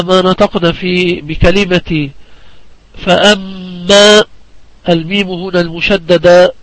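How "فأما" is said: فأما with the مّ drawn out nasally for two counts; ghunnah held two counts. Shown as a demonstration. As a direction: hold the doubled meem of فأما in a long nasal hum. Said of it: "غنتها مقدار حركتين".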